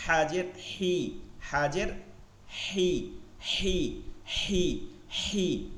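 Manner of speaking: হি slow, thin and light; thin ha demo. Shown as a demonstration. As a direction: model হি thin, slowly. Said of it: "বলার চেষ্টা করে দেখেন আমার মতন". (চিকন করে হি, ধীরে)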